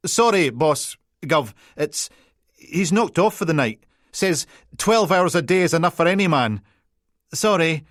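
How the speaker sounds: high, loud, tumbling Peterhead apologies; flustered Peterhead PC. Acted. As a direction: backpedal fast in broad Peterhead. PC apologising at attention.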